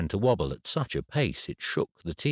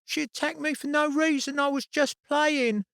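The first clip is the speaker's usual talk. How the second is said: put-on very high, whiny child's voice; a boy whining to get his way; child whinging through the eardrums. A narrator's reading. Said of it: "with a whinging voice".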